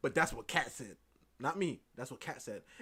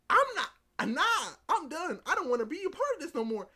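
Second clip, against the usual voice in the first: loud, high, emphatic quitting; hammed-up storming out; mock fed-up refusal; 13 semitones higher, 11 dB louder than usual. Doki quitting the whole affair, loud high mock protest.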